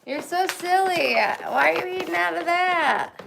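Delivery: high, loud doting voice; high pet talk. Real, not acted. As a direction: tease the pet fondly. Scolding pet fondly.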